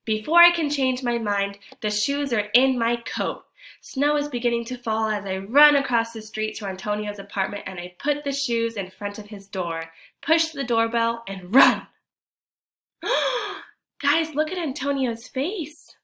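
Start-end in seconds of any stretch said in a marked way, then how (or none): 0.0-11.9 storytime reading voice, a touch lively; story narration. A narrator's reading. Teacher reads the picture book to kids, lively narration. 13.0-13.6 high sharp gasp; surprised gasp. Acted. Teacher reacts to Antonio's surprise for the class, high gasp.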